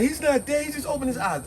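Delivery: a high panicked squeal of disbelief; hysterical, high-pitched. Retold or imitated. Annette panicking to Rhoda over the body, high and frantic. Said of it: "hysterical as Annette was".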